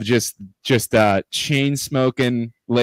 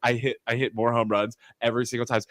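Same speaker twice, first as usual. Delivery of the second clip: usual speaking voice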